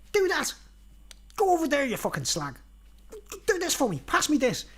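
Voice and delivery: high-pitched imitation of a bossy leprechaun; rude leprechaun. Leprechaun ordering a woman about, mimicked high and rude. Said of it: "being really rude to her and everything".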